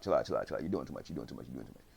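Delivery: low muttered repeats, fading away; repetitive talking down. Retold or imitated. Suho restraining his friend, low fading repeats.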